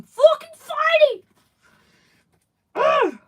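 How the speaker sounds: strained cry through sharp stomach pain; stomach cramps. Real, not acted. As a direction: fight through a cramp, voice jumping high and loud. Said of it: "My stomach is killing me".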